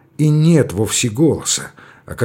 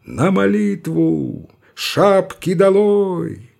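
higher, slow, drawn-out call; parade command; 6 semitones higher, much slower than usual. Acted. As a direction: call it across the square. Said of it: "Раздалась команда".

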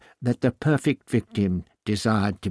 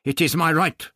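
stubborn; 6 semitones higher, 6 dB louder than usual. A narrator's reading. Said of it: "Ixter replied stubbornly".

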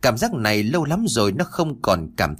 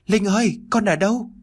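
gọi lớn; 10 semitones higher than usual. Acted. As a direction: call out anxiously for a lost child. Cha mẹ gọi con từ xa. (gọi to hốt hoảng)

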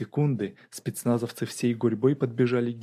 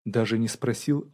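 plain reading voice

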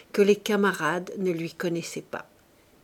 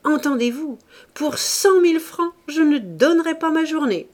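beaucoup plus haut et plus fort; exalté; 8 semitones higher, 7 dB louder than usual. Acted. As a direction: lancer le défi avec fougue. Claude exalté défie ses camarades. (Claude aux camarades, défi exalté et fort)

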